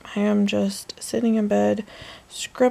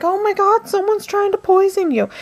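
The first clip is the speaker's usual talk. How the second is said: high-pitched retold panic; freaking out; 12 semitones higher than usual. Pet owner telling cat someone's poisoning it, mock panic. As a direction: act out alarm, pitch jumping way up. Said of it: "freaking out".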